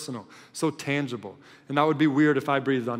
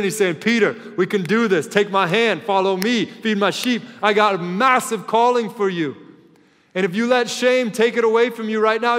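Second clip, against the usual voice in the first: louder and higher, driving and passionate; full-force preaching; 7 semitones higher, 7 dB louder than usual. Acted. The preacher voices Jesus rallying Peter with passionate, full-force preaching. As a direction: preach it out with rising fire.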